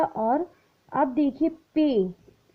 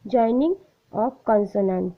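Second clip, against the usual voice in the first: slow dictation; much slower than usual. Shown as a demonstration. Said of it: "क्या सीखेंगे हम?".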